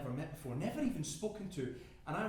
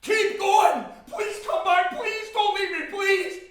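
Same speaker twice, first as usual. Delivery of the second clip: high, desperate screamed pleas; screaming; 16 dB louder, much slower than usual. Acted. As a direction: scream after people leaving you behind. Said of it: "and I scream".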